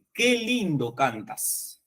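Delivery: slow, "Qué" stretched and stressed. Shown as a demonstration. Teacher repeats the emphatic example slowly for the class. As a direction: linger on "Qué" like real admiration. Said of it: "que es diferente de decir".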